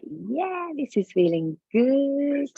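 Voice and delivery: delighted high "yeah". Pretend. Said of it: "You're like".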